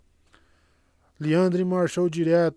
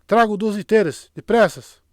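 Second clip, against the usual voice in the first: loud, raised-pitch urgent call; shouted order; 7 semitones higher, 8 dB louder than usual. A narrator's reading. Moiraine urgently ordering two stretchers, shouting. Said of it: "Alguém gritou pedindo liteiras".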